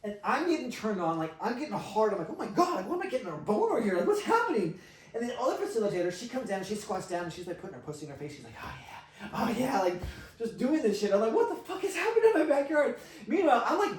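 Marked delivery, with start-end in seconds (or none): 2.3-4.8 high, loud, laughing panic; mock alarm. Storyteller reliving his embarrassment at his own arousal, comic alarm. 8.6-9.8 high mock moan building from breath to loud; mimicked ecstasy. Storyteller mimicking the other facilitator's pleasure, mock ecstatic moan. 11.3-13.0 very high, loud, laughing disbelief; incredulous outburst. Retold or imitated. Host reliving the scene in his backyard, hammed-up incredulous outburst. Acting his shocked reaction.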